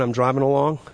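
normal talking voice

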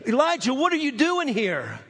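high, searching question; a lightly voiced divine challenge; God asking Elijah, high-pitched; God asks. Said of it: "to Elijah in First Kings".

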